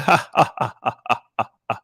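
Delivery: a forced, polite laugh that dies away; mocking a nervous fake chuckle.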